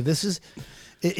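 ordinary delivery

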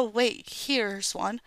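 ordinary talk

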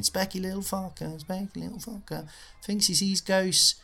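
high sneering taunt; mocking lads. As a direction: chant it like jeering bullies. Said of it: "they all started pointing at him, laughing".

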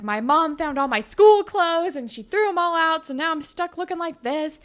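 put-on high, put-upon teen grievance; acted whiny complaint. Allie explains her outfit to Claire, acted complaint. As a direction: imitate a friend griping, starting loud and high.

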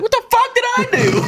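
high, laughing imitation of a confused coworker; laughing mock outrage. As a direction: squeak it out through laughter. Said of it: "he was like".

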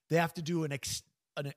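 breaking off on a hiccup. Real, not acted. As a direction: hiccup cuts the words. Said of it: "sorry about these hiccups".

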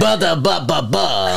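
jingle in a slow mock-Russian accent. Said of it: "Russia edition".

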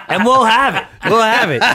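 self-mocking laughter; laughing. Real, not acted. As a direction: chuckle it out at your own excuses. Laughing at own procrastination.

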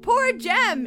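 loud shout; yelled. Retold or imitated. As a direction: yell it in her face. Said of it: "you grabbed me and yelled".

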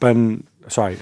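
normal talking voice

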